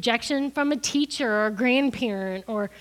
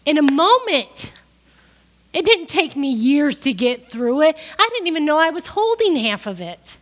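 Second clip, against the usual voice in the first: loud, higher, driving sermon delivery; preaching at full force; 4 semitones higher, 7 dB louder than usual. Acted. Preacher rejecting the inner healing teaching, loud and forceful. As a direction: preach it, push back against the teaching.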